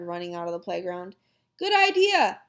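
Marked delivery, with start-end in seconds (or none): 1.6-2.4 higher, louder call back. A narrator's reading. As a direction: call it across the playground. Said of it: "I called".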